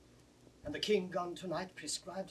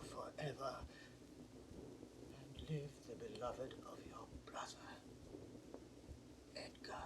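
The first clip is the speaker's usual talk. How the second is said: whisper; 3 semitones lower, 8 dB quieter than usual. Acted. Gloucester reads the letter aloud.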